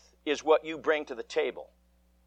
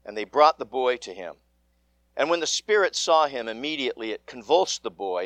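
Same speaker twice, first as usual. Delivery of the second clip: measured, solemn scripture reading; gospel reading; 4 semitones lower than usual. Acted. Priest reading the gospel to the congregation, solemn and measured. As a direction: read the gospel aloud to the congregation.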